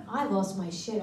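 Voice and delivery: normal talking voice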